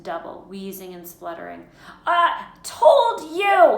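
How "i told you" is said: drawn-out, high, loud gasp; winded, high-pitched scolding; gasped reproach. A narrator's reading. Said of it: "Hermione gasped".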